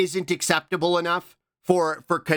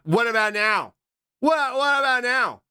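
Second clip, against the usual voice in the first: taunting yell; 9 semitones higher than usual. Acted. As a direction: shout it up at them each time higher. Taunting chat with meme.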